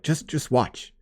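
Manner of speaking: high-pitched plea, building in urgency; exasperated parental pleading. Retold or imitated. Host voices dad pleading with questioning son, exasperated. Retells a father pleading for patience.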